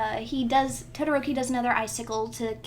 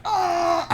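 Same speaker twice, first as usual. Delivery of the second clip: high, loud mock groan; hammed-up wail of pain; pained cry; 7 semitones higher, 7 dB louder than usual. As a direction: cry out like a chained-up victim. Imitates chained victims crying out.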